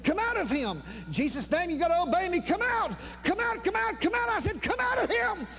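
high, ringing command to the devil; commanding exorcism. Retold or imitated. Preacher commanding the devil to leave. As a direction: order the devil out, pitch climbing.

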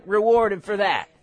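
ordinary talk, nothing marked

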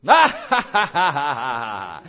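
big put-on laugh tumbling down in pitch; spirit man's laugh. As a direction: burst out in a mock carefree cackle. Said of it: "You know what your spirit man's doing".

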